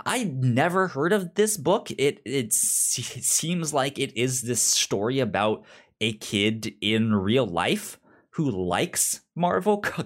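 normal talking voice

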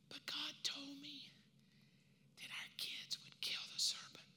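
fading voice from the deathbed; dying whisper. Acted. Dying Eve whispering a question to her husband.

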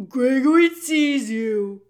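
put-on loud, booming old jailer who speaks of himself by name; the jailer Gregory greeting Meg in a big theatrical voice. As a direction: boom it out like a grand old jailer. Gregory to the shadow.

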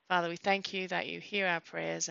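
ordinary delivery

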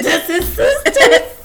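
high, loud and slow, laughing; excited. Retold or imitated. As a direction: squeal it out, overjoyed. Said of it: "I was like".

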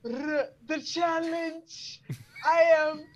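very slow, put-on voice with drawn-out words; mimicking Zuri. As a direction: overplay it like the grand old elder. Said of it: "Zuri, uh, say".

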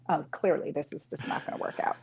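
whispered, fast aside; a hushed mock verdict. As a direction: breathe it out quickly, half joking. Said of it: "I'm like".

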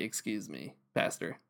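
quiet, snooty nerd correcting pastor; prim know-it-all interrupting. Retold or imitated. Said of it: "it's my derogatory nerd voice".

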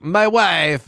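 lightly voiced Borat catchphrase; mock Borat accent. Riffing on Borat for the hosts, imitating Borat accent. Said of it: "Peter Sellers could have been like".

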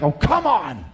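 exasperated whine played for laughs; grumpy. Hungry diner griping at a full restaurant, mock-grumpy. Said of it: "I was feeling a bit grumpy".

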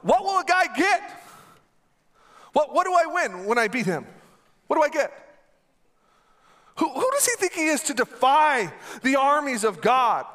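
eager to claim the prize; bold David. Acted. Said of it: "he says, like".

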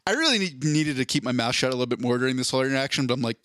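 ordinary delivery

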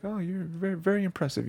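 high, sugary mock compliment; lightly voiced villain flattering the boy; sly praise. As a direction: coo it up high like a smug old schemer. Snake praises Thorfinn, acted.

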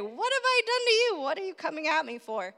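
high, stung protest to his brothers; indignant protest. Retold or imitated. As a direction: push back, hurt and baffled. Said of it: "That's basically what he's saying".

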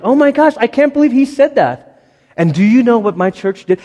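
high, mocking voice of an offended churchgoer; hammed-up offended gossip; mock outrage. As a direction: play up the whining complaint.

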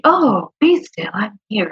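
a lightly voiced sugary reassurance; cushy comforting God. Mother mocking the cushy reading of the verse, mock soothing. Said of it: "It's not a cushy verse".

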